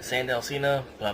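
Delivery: normal talking voice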